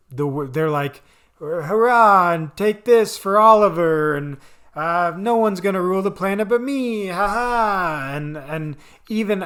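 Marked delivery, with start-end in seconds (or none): 1.4-4.2 higher, loud cheesy superhero cheer; rally the troops like a hammy hero; hammed-up battle cry; mock heroic cry. 5.2-8.1 higher-pitched cheesy superhero bravado; hammed-up boast with a fake laugh; mock heroic boast. Cheesy battle banter, acted out.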